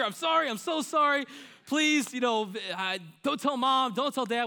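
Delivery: put-on high, frantic childlike apology; pleading child. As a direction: beg your sister not to tell. Child pleading after hurting sister.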